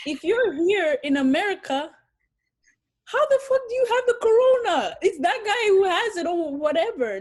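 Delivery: loud, high exasperated outburst; incredulous near-shout; outraged disbelief. Real, not acted. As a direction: burst out at the absurdity, voice shooting up. Outraged at illogical blame.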